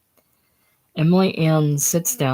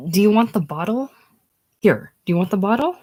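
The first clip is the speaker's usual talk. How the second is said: higher, urgent questioning; frazzled, pitched-up plea; exasperated offer; 5 semitones higher, 4 dB louder, faster than usual. A narrator's reading. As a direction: press the baby for an answer, exasperated. Frazzled mother pleading with crying baby.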